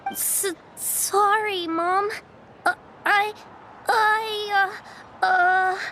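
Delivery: slow, halting apology on the edge of tears; scared little girl stammering sorry to her mom; tearful stammer. Acted. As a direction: stammer it out like a scolded child about to cry. Child scolded by mother for lying.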